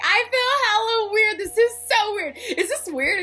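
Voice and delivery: high, jittery, freaking out a little; nervous excitement. Retold or imitated. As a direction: squeal it like you can't handle the nerves. Nervous before meeting the band.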